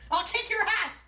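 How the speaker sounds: put-on high, slurred drunk threat; belligerent drunk. Repeated clip line.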